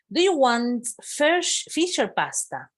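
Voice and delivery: high, slow, bright-voiced offer like a flight attendant; teacher playing the cabin crew, asking high and slow; lively model question. Shown as a demonstration. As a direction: ask it up high and slowly, like serving a passenger. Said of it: "teacher models flight attendant question".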